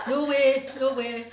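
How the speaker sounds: loud, high, laughing refusal. Real, not acted. Friend backing up the host in a joke fight, laughing.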